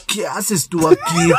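slow, hammed-up question; telenovela confrontation. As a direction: draw it out like a soap-opera reveal. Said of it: "Y yo dije".

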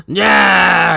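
drawn-out growled wrestler shout; gravelly wrestler yell. Retold or imitated. Podcaster mimicking over-the-top eighties wrestlers, gravelly yell. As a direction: imitate a yelling promo wrestler. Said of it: "make their voice like that".

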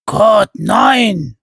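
loud, high cry of a giant; a giant blurting out a protest; big booming cry of alarm; giant cries out. A narrator's reading. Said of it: "rief der zweite Riese".

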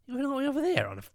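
high, incredulous mock question; incredulous quote. Retelling to a mate, high incredulous mock question. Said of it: "And I went".

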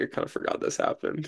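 hushed aside to self; whispered thought. Retold or imitated. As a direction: breathe it out like a private thought. Quoting own past thought.

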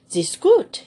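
put-on high, loud jolly old German; hearty German greeting. Eccentric music master greeting Madge, loud and jolly. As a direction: boom a cheery hello in German.

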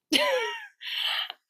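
very high giggle, falling; high laugh. Real, not acted. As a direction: laugh at her own boldness. Laughing after walking out.

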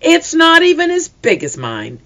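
put-on very high, defiant childish boast; piped up proudly; little sister topping the older girls. A narrator's reading. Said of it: "piped up in a defiant voice".

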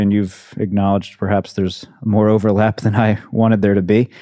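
ordinary talk

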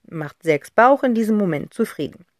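ordinary delivery

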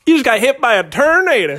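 high, loud hammed-up Southern twang; Southern accent. Asking what kind of country accent, hammed-up Southern accent. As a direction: put on an over-the-top Southern accent. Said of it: "what kind of Southern accent".

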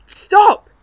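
loud, high shout; desperate shout. Acted. Haunted man screams at the voices to stop, loud and high.

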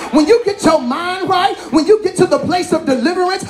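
climbing sermon shout, almost a chant; full-force preaching. Acted. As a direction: preach it out to the whole church. Preaching rises again.

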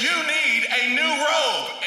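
high, slow, drawn-out proclamation; imitated guest preacher. Guest preacher singling out one listener, high slow proclamation. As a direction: proclaim it as the preacher, stretching each word high. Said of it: "he's preaching and he goes".

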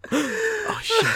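high gasped "oh shit"; startled exclamation. Retold or imitated. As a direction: blurt it in shock. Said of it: "I was like, gasps".

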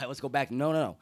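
ordinary delivery

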